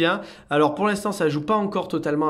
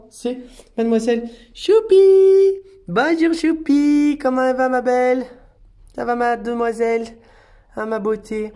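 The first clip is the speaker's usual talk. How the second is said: excited pet greeting; 8 semitones higher, 6 dB louder than usual. Real, not acted. Carer greeting Choupi before she goes home, high excited cooing.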